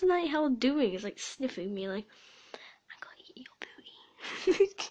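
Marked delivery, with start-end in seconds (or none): 2.5-4.8 hushed, playful creature threat; mock whisper. Gamer voices the player sniffing her, mock whisper. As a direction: pretend whisper, squeaking up at the end. Voicing what Night Owl is doing.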